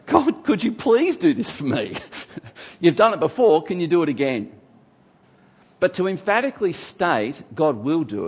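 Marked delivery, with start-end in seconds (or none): 0.1-4.5 high, gentle plea with a small laugh; humble prayer. Shown as a demonstration. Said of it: "pray this prayer".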